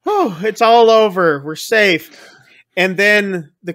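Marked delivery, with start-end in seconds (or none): 0.1-2.3 high "whoo" settling down in relief; acted relief. Diners think the gunman has saved them, acted relief. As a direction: imitate a crowd sighing with relief. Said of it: "everyone's relieved".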